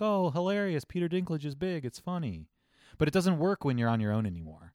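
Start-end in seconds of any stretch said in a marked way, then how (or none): 0.0-2.5 high, gushing audience reaction; mock amusement. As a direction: play the easily delighted moviegoer. Acting a delighted cinema audience.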